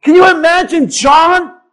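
ordinary talk

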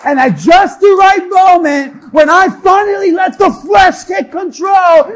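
shouted comic exasperation; full-force preaching. Real, not acted. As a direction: belt it out to the crowd.

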